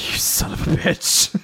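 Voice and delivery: whispered through laughter; mock curse through laughs; stifled-laugh whisper. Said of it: "Fumito like".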